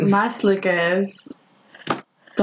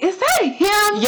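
high, slow disbelieving question; surprised; 11 semitones higher, slower than usual. Retold or imitated. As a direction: squint and ask if it's really him. Recognizing actor, surprised.

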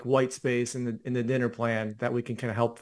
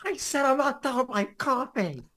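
high, strained imitation of yelling; mock irritated yell; 11 semitones higher than usual. Teasing co-host's annoyed reply to wife, high mock yell. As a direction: push it up high with furrowed brows. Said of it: "Do your eyebrows furrow".

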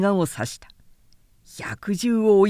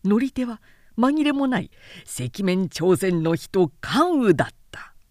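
ordinary delivery, nothing marked

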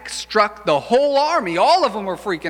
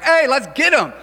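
rallying cry; 8 semitones higher, 4 dB louder than usual. Retold or imitated. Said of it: "they're like".

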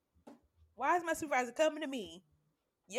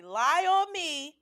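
louder, slowed threat; slow loud warning; 5 semitones higher, 9 dB louder, much slower than usual. Retold or imitated. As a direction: lay each word down as a warning. Retold warning to coworker.

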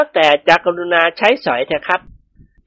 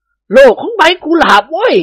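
a higher, louder, slow exasperated retort; irritated; 10 semitones higher, 5 dB louder, slower than usual. Acted. A master fed up with a servant's silly question, exasperated. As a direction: spell it out, fed up. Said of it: "เจ้าคุณศรีจุปาก".

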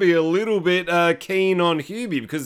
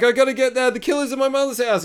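high, urgent worried cry; mock panic; 8 semitones higher, faster than usual. Hubie rushing to save his mother, high mock panic. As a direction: retell it high and alarmed.

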